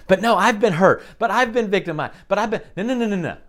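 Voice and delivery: hammed-up victim's excuse, cut off by quick no's; mock protest. Voices the hurt person's protest.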